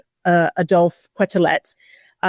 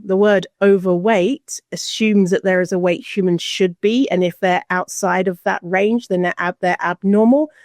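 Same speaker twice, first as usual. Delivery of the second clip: usual speaking voice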